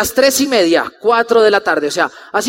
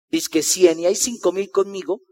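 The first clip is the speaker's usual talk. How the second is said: low, soft grumble to himself; muted, lower aside, half laughing at first; sceptical aside; 8 semitones lower, 8 dB quieter than usual. Retold or imitated. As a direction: drop low and quiet, doubting the whole crowd. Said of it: "y yo decía".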